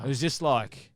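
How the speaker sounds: ordinary talk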